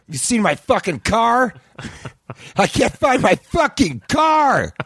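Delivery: high, slow, strained rant of a furious sketch artist; angry caricature artist. Acted. Caricature artist screeching at a customer about his lost car. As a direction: screech it out like a washed-up artist losing it.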